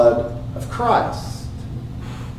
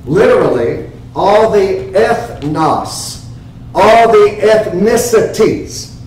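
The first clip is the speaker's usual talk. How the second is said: usual speaking voice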